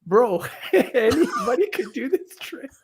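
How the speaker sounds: high, slow, laughing through the words; "Bro, anybody could do this trade" cracking up; laughing mockery. Fans in the chat mocking the Clippers trade, laughing.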